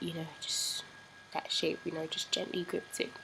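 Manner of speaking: hushed. Real, not acted. Hushed story detail.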